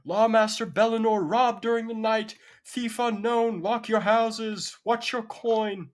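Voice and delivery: high, loud public proclamation; shouting town crier. Acted. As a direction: call out a warning to the whole street. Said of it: "you hear town criers shouting".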